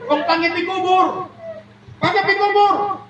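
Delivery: squeaky gossiping villagers; high comic chatter. Acted. Neighbours chatter about the burial in high voices.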